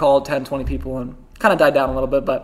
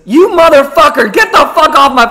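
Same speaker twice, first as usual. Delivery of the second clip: put-on old man screaming curses down the phone; cursing out; 11 semitones higher, 11 dB louder than usual. Old man cursing out the cold caller, furious yell. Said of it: "just goes off on me".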